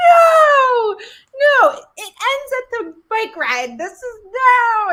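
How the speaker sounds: high, wailing mock protest; imitated screaming. Friend imitates Ari upset by the film's ending, mock screaming. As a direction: stretch each no into a hammed-up scream. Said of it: "He's screaming from the other room".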